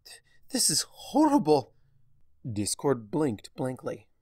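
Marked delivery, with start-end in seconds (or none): 0.0-1.7 higher and louder, stammering in horror; horrified gasp. Acted. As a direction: choke it out, sickened and shaken. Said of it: "swallowed against her building nausea".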